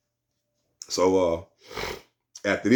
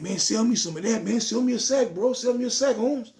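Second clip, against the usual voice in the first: much higher, eager clerk asking to buy; voicing the clerk; 12 semitones higher than usual. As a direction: pitch it way up, pleading to buy. Clerk begging for weed.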